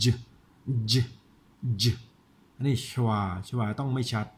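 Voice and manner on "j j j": j as a short voiced "juh", near Thai จ; isolated consonant sound. Shown as a demonstration. Teacher showing it only resembles จอจาน, isolated consonant sound. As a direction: push out a brief buzzing j alone. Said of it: "แต่แค่คล้ายๆ".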